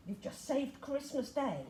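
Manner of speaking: usual speaking voice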